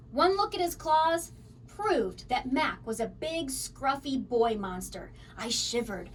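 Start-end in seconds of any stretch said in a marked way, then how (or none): none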